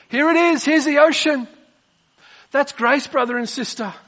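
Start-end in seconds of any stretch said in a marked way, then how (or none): 0.1-1.5 high, animated acted offer; high-pitched, generous exclamation; exclaimed offer. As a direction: present it grandly, pitched right up. Ocean answers the thirsty little fish.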